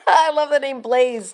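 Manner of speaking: laughing loudly through "I love the name Blaze"; delighted laugh over the name Blaze. Real, not acted. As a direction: burst out laughing as you say it. Gushing over puppy names.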